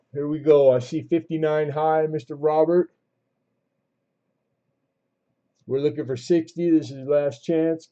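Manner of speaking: lively auction calling; auctioneer call. Real, not acted. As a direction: call out the bids to the room.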